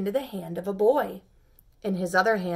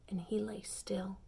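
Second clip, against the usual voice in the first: hushed; 10 dB quieter than usual. A narrator's reading. Hushed narration of stillness.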